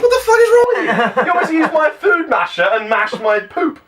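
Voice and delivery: high-pitched outraged shout; outraged yell, laughing. Retold or imitated. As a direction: yell it in disbelief. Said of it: "I'm like".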